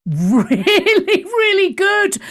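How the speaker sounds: "Really, really good" laughed out, jumping high; laughing. Real, not acted. Laughs through repeated praise.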